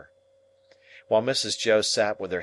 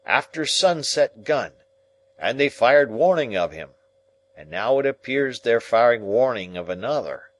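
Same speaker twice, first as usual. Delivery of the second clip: aloud; 4 semitones higher, 6 dB louder than usual. Acted. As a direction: say it out loud for all to hear. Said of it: "said Joe aloud".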